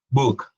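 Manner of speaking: Book said as buk, short u-like vowel; English pronunciation demo. Shown as a demonstration. Teacher repeats the word for students. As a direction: model the vowel clearly, like a U. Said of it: "es un sonido de, de una U".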